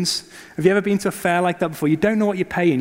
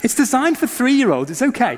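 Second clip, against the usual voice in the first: nervous self-reassurance; 6 semitones higher than usual. Retold or imitated. Father reassuring himself as coaster climbs.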